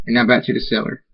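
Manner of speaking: plain reading voice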